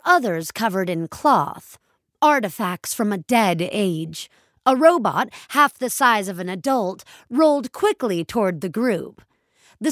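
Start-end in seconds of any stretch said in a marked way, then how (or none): none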